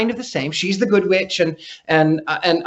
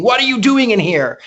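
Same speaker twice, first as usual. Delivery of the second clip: higher, louder mock yell at an intruder; retold scream; 6 semitones higher, 4 dB louder than usual. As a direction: Victorian ghost's half-hearted shouted demand.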